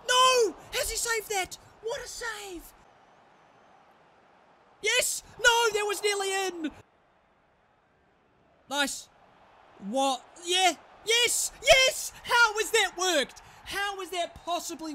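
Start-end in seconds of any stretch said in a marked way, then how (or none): 0.1-6.7 very high, loud cry of dismay; anguished shout. Real, not acted. Gamer watching a shot saved, anguished high shout. 10.5-14.9 very high, loud, disbelieving cheer; ecstatic yell. Real, not acted. Gamer celebrating a fluky goal, ecstatic yell.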